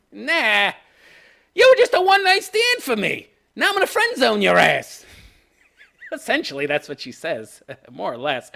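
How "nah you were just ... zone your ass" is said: put-on much higher, louder dismissive girl voice; mock Arya brush-off. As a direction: wave him off in a hammed-up Arya voice. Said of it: "Arya says".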